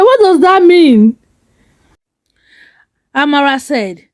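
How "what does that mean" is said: hurt. Acted. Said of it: "Ade hurt".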